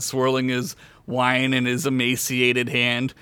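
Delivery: ordinary talk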